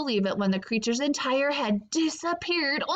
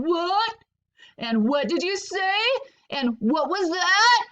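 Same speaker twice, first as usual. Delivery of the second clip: shouting; 5 semitones higher, 3 dB louder than usual. Acted.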